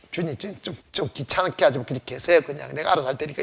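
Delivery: low, hushed brush-off; dismissive aside. Acted. Believer tells the Lord to stay out of it, low and dismissive. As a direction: mutter it softly, waving someone away.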